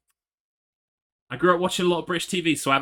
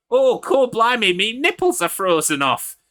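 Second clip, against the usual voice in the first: hammed-up funny British accent, much higher and louder, slow; mock dub voice in an exaggerated British accent; silly overdubbed British accent for a cold nudist; 8 semitones higher, 8 dB louder, slower than usual. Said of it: "someone with a funny accent, a funny U K accent".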